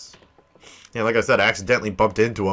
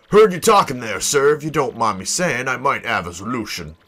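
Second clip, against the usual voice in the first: put-on deferential old salt; 3 dB louder than usual. Sailor offers his boss a solution, deferential. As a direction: pipe up to the boss as a deckhand. Voices sailor addressing Flavio.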